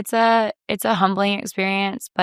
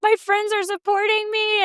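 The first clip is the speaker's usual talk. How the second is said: much higher, choked-up retelling; teary emotional. Retold or imitated. To her cohosts, recalling friends' support: teary high retelling. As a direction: voice lifts as if about to cry. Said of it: "I started crying".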